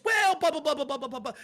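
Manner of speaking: mocking the debate opponent's rambling non-answers, loud and high; mocking evasive babble. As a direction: parody a debater dodging the question.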